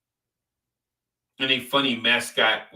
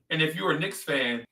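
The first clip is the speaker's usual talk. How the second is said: ordinary talk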